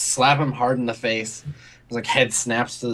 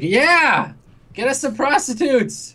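put-on drunken calling out; 11 semitones higher, slower than usual. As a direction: call out gleefully over the other man's phone call.

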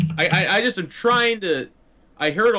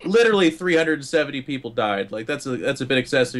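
normal talking voice

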